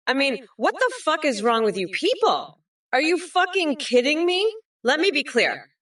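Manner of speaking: outraged. Acted. Fed-up candidate berating people, outraged. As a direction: berate them in indignant disbelief.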